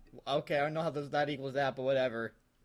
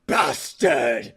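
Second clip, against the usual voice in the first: loud, sharp outburst of a curse; angry shout; 4 semitones lower, 9 dB louder than usual. Acted. Reading a goth fanfic aloud, shouted curse. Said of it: "I shouted angrily".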